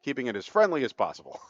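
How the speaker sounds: usual speaking voice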